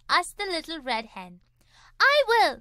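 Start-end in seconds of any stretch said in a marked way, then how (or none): 2.0-2.6 higher, louder eager duck. A narrator's reading. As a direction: jump in fast. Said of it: "said the duck quickly".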